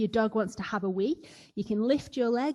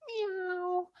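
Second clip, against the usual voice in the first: a much higher, softer kitten meow; happy cat meow; 10 semitones higher, 6 dB quieter than usual. Pretend. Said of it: "Happy cat".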